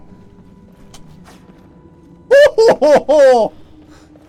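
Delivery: loud, high-pitched hooting laugh; thrilled laugh. Real, not acted. Thrilled by new enchantment.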